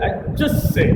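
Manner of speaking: "just say" pitched high, punched on JUST; modulated delivery. Shown as a demonstration. Coach demonstrating modulation to speakers, lively and high. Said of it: "I would have said, I would just say".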